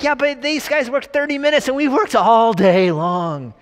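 high, loud, drawn-out mock whine; childish complaining workers. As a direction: stretch out the protest like a wronged child. Acted workers complain of unfair pay.

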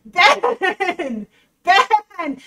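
shouted name through laughter; laughing disbelief. Real, not acted. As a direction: call him out, laughing hard.

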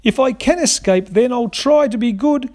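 very high, wailing bird voice; howling apology. A narrator's reading. Cockatoo in the dingo's jaws promising to be good, high wailing howl. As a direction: wail out your sorry in a high cockatoo howl. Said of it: "Cocky howled out".

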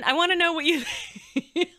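quick question collapsing into a laugh; real giggle overtaking the sentence; breaking into laughter. Real, not acted. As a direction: laugh through the last words. Breaks up while asking listeners.

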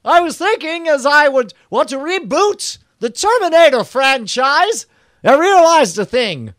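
an absent-minded inventor plutocrat giving orders; hammed-up high James Cameron monologue; James Cameron impression. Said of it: "He's like".

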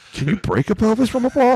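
high, incredulous retold question; baffled question. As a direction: ask it like you truly can't believe it. Retold confused question to teammates.

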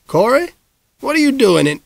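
high, sudden startled call, cut off mid-word; a man caught off guard, breaking off; startled mayor. Acted. Mayor catches boy in closet.

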